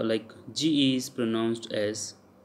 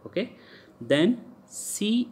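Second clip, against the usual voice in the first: ordinary delivery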